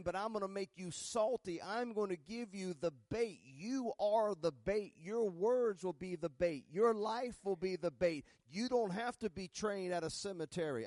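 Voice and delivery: loud, high, fervent preaching, slowing on the key lines; full-force preaching. Acted. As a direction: rouse the congregation, building to a climax.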